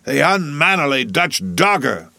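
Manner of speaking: slow, high-pitched emphatic insult; angry cry. A narrator's reading. Stubb curses the German rival, angry cry. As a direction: hurl the insult, drawing it out. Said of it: "cried Stubb".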